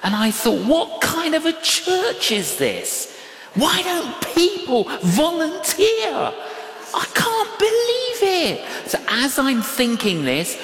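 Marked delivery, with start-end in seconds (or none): 0.6-8.6 incredulous outburst. Acted. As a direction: act out appalled disbelief, pitched way up. Acting out his indignant thought in church.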